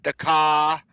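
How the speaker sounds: put-on Boston accent, "car" said as "cah" with no R; imitating Boston, "The car" with the R dropped. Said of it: "they don't pronounce the R".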